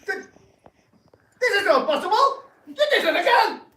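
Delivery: high, very loud laughing protest; frantic disbelief. Acted. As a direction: lose it completely, shrieking. Shocked loss, wild protest.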